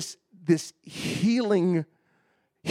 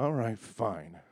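low, soft, reluctant agreement; grudging surrender; 4 semitones lower, 7 dB quieter, slower than usual. Retold or imitated. Husband giving in to prophet, grudging. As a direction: give in under pressure, quietly.

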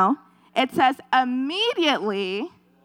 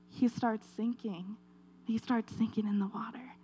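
whispered, much softer; a soft, breathless whisper as Peter sinks; hushed narration; 2 semitones lower, 12 dB quieter than usual. A narrator's reading.

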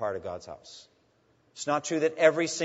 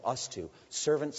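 ordinary talk

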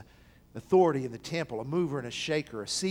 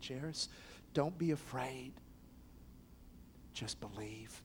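soft reassurance; 3 semitones lower, 14 dB quieter than usual. Acted. Jesus comforting grieving father.

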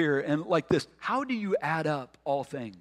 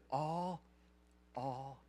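soft, spaced-out "all, all, all", sinking lower at the end; hushed repetition; 3 semitones lower, 11 dB quieter, slower than usual. Real, not acted. Preacher closes on forgiveness of all sin, hushed. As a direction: let each "all" settle, much softer.

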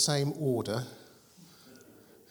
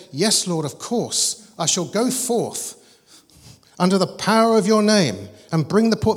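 grand declaration, building louder and higher; mock-heroic obedience; 4 semitones higher, 7 dB louder than usual. What Moses didn't say to God, mock-grand obedience. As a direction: proclaim it like an overeager hero.

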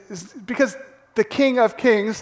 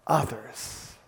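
normal talking voice

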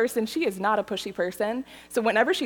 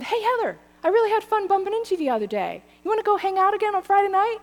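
upbeat caller; 10 semitones higher, much faster than usual. Retold or imitated. Quoting Adam's voicemail.

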